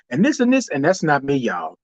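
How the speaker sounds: ordinary talk, nothing marked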